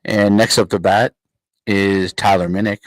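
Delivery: booming ballpark announcer read; mock PA announcer. Mock announcer calling the next batter, booming.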